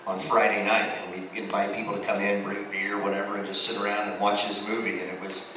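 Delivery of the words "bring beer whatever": ordinary delivery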